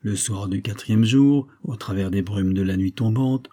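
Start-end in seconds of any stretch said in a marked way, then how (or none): none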